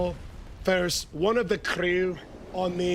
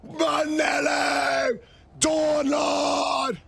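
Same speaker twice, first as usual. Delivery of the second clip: victory shouts; 8 semitones higher, much slower than usual. Real, not acted. Streamer shouting out chatters after a win, cheering. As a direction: yell each name like a triumph.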